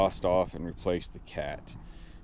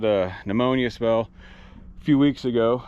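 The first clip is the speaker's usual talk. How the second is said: ordinary delivery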